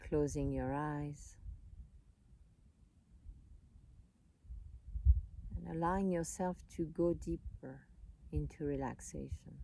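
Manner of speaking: calm, unhurried voice with long pauses; soothing meditation guide, leaving space between phrases; guided meditation. Real, not acted.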